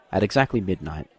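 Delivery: usual speaking voice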